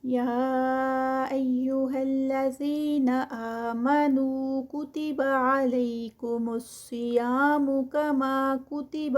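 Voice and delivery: slow, drawn-out tajweed recitation; Quranic recitation. Shown as a demonstration. Teacher reciting the verse for students, slow and melodic. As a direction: chant each word slowly, stretching the vowels.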